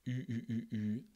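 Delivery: "U" with rounded, pushed-forward lips; whispered French U. Shown as a demonstration. Teacher shows the French U, whispered. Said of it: "c'est un son très français".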